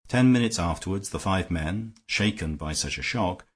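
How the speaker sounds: usual speaking voice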